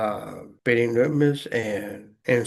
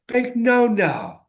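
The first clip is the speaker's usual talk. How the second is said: higher, playful call-out; zitierter Filmzuruf; 12 semitones higher, 4 dB louder than usual. Retold or imitated. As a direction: cry it out high, like heckling the TV. Imitating film character talking to TV.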